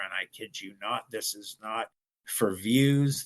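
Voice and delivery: normal talking voice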